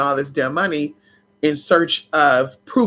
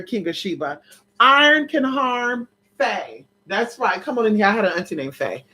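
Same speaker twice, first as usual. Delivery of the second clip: normal talking voice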